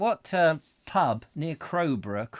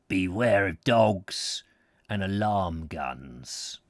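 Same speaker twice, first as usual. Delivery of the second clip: stern warning sign; 6 semitones lower, slower than usual. Acted. Reading a warning sign aloud.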